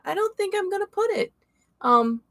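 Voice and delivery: high, put-on voice for my own thought; silly high inner voice; mock self-talk. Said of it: "and I was like".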